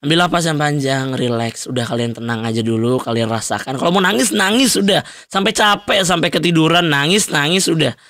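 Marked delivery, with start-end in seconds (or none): 3.8-8.0 high, quick insistent urging; real heated pep talk; urgent encouragement. Real, not acted. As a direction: push them to let it all out. Urging listeners to cry it out.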